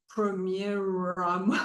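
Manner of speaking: drawn-out R in premier; laboured R in premier. Shown as a demonstration. Lecturer showing a hard liaison, R laboured and drawn out. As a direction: stretch the R of premier slowly into the next word. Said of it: "if you don't pronounce the R well".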